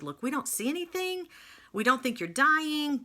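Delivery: a hint of put-on medical verdict; imitating doctors.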